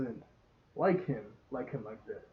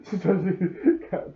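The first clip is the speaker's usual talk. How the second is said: louder, higher, words broken by laughter; laughing; 5 semitones higher, 5 dB louder, slower than usual. Real, not acted. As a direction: laugh your way into the sentence. Laughs at the character's slip.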